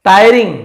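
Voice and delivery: टायरिंग said high and loud as TAI-ring, falling; teacher's loud model of टायरिंग; model pronunciation. Shown as a demonstration. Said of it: "इसे टायरिंग पढ़ा था".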